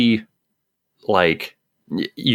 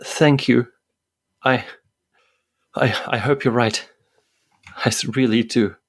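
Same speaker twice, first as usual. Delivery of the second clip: higher, halting grateful actress; a touch of a fragile actress thanking him; hesitant thanks; 5 semitones higher than usual. Acted. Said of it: "hesitates, then nods and says".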